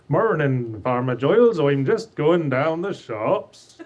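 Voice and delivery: broad West Country farmer, drawn-out last words; lilting West Country villager; West Country accent. Shown as a demonstration. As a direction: do a rustic West Country greeting. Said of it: "That's the West Country accent".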